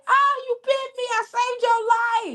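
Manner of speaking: high-pitched ditzy lady in a joke; shrill lady. Acted. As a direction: play the panicked lady. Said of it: "She said".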